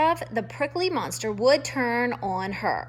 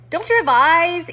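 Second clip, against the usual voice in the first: loud, higher screech; 6 semitones higher, 9 dB louder than usual. A narrator's reading. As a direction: shriek it at the fool. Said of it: "Iris screeched".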